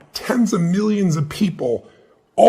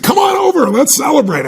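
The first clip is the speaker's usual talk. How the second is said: excited invite; 10 semitones higher, 5 dB louder than usual. Retold or imitated. Friend invites him, birthday.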